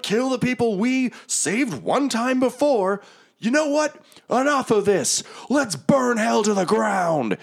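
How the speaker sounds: high, impassioned battle speech; rally the knights in a high, forceful voice; Zariel rallying troops. Acted. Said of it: "and just says".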